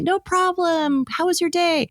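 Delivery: put-on sweet as pie to the kids; sugary sweet. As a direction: mom sweetly greeting kids who are late. Mom greeting late kids sweetly.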